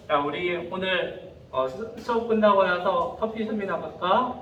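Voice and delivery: low, halting, tentative asking-out; acted awkward, softened invitation full of pauses; hesitant invitation. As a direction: fumble through asking your crush out, with fillers. Acting shy invitation to a crush.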